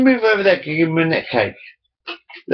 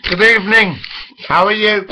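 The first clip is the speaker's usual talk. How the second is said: raised, louder host voice; announcer greeting; 6 semitones higher, 4 dB louder than usual. Pretend. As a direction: greet like a TV presenter. Mock formal greeting, higher, louder.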